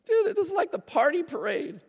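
high, puzzled, casual voice of a buddy; confused disciple. Retold or imitated. Pastor voicing a disciple puzzled at crying Jesus, high and baffled. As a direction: play a baffled friend asking what's wrong. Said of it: "his disciples being like".

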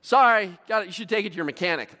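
high, loud careless mechanic voice; mock-cheerful mechanic, loud and high; breezy mechanic. Jiffy Lube worker brushing off.

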